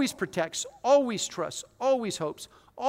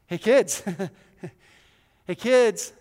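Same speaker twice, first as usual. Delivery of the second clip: mock spooky dad; 6 dB louder, slower than usual.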